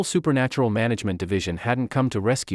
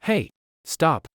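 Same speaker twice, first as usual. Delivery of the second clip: sharp startled shout, slightly higher; shouted stop; 6 semitones higher than usual. Acted. Panicked man shouts at undressing girl to stop. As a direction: yell at her to stop. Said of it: "jumped up and shouted".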